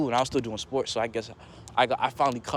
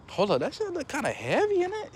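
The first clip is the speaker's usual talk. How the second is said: alarmed; 12 semitones higher than usual. Retold or imitated. Retelling own alarmed remark.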